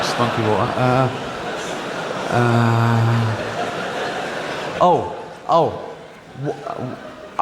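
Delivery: halting, stammering excuses; a caught-out wife scrambling for a story; lightly voiced flustered Mary. Joseph and Mary acted scene.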